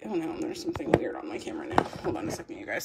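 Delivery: whispering. Real, not acted. Camera trouble, muttered aside.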